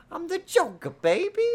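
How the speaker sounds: extremely high squeaky Joker shout; hammed-up cringe Joker audition. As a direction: scream it like an overeager audition. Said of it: "here's my Joker audition. And then he goes".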